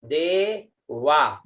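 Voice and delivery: देवा drawn out slowly; slow pronunciation. Shown as a demonstration. Teacher models देवा slowly. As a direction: stretch out देवा. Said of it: "उसके बाद में आता है देवा".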